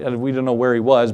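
ordinary talk, nothing marked

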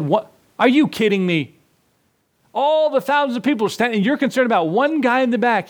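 can't believe what he's hearing; incredulous friend. Retold or imitated.

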